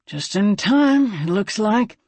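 gruff character voice, slowing at the start; drawn-out, gruff remark. A narrator's reading. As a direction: grumble it in character. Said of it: "Slim observed gruffly".

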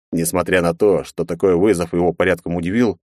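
usual speaking voice

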